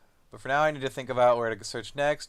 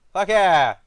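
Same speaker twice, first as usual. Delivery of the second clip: loud, high cry of victory; triumphant cheer; 6 semitones higher, 11 dB louder than usual. Real, not acted. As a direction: shout it like you just won. Player cheers at charisma success.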